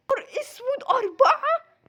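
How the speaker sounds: high, loud disbelief; shocked outburst. Acted. Patient hears she is carrying four, high shocked outburst. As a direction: exclaim in rising shock.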